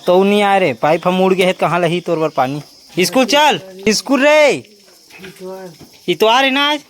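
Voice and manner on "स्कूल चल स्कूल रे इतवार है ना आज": high, loud playful calling; loud teasing call. Real, not acted. Teasing the kids about school on Sunday, loud high call. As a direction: call out to them, half joking.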